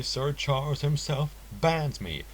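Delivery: plain reading voice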